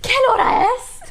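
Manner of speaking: high-pitched mock panic; hammed-up stressed question; stressed time traveler. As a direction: play a frantic, just-arrived time traveler. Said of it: "Like, you're really stressed, you just time traveled".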